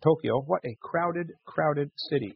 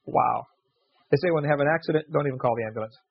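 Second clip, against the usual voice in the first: ordinary talk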